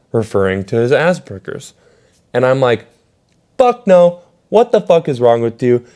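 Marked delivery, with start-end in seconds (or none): 3.6-5.8 furious retold shout, much higher and louder; outraged. Shouting at the music teacher over Cole, outraged. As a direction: blast her with disbelief.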